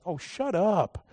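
slow, flat, dismissive God voice, set apart from his own; a weary listener's slow brush-off; God cutting him off. Retold or imitated. God tells the excuse-maker to stop, slowly and flatly. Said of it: "and he's like".

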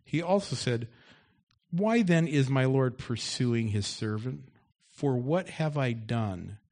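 loud preaching read of the story; loud scripture reading. Acted. Pastor reads David's taunt aloud to the congregation, loud and forceful. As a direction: boom the scripture out to the church.

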